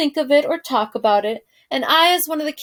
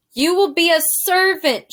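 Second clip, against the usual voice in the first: loud, higher shout; 6 semitones higher, 5 dB louder than usual. Acted. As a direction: shout it clearly for a deaf girl. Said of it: "shouted the soldier".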